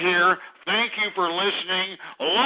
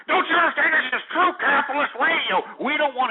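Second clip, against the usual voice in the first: angry; 8 semitones higher than usual. Real, not acted. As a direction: yell it at them. Continued rant at caller.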